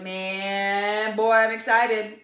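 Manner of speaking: playing the eager beginner, slow and loud; mock excited. Coach voicing a new member's start, mock excited. Acting out start of health journey.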